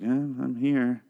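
slow, soft and awkward mumble; half-hearted, uneasy acknowledgement; uncomfortable. Retold or imitated. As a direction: drag it out, reluctant to be there. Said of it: "super uncomfortable".

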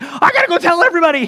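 high, loud, rushed excitement; frantic, hurried imitation of an onlooker; going crazy. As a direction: burst out like you must spread the news. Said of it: "kinda going crazy".

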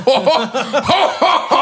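high jolly Santa ho-ho-ho; Santa laugh. Retold or imitated. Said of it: "staring down at us like".

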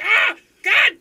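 high-pitched, full-volume panicked yell. Retold or imitated. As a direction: scream it like you're freaking out mid-game. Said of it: "I'm, like, yelling".

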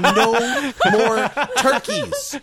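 slow, laughing declaration; drawn out through a laugh. Real, not acted. Escalating joke.

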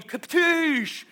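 high explosive "katoosh"; imitated kick impact. Pastor shows horse kicking his thigh, explosive sound. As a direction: burst out a high crash noise.

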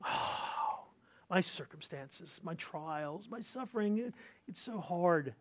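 soft, sighing moan of self-pity; mock self-pitying groan; groaning complaint. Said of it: "It's groaning".

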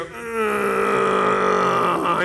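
loud drawn-out groan; dog moan. Retold or imitated. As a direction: groan like a weary old dog. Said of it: "he would just go".

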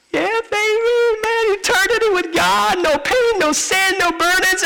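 a swaggering believer who thinks he made it; gleeful "yeah baby" at the gates; carefree excitement. Pretend. Arriving at heaven, carefree.

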